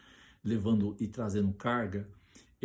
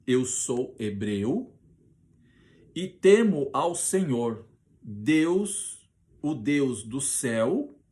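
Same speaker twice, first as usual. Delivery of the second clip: solemn declaration; 5 dB louder, slower than usual. Acted. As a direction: proclaim it slowly with full force. Jonas declaring his faith.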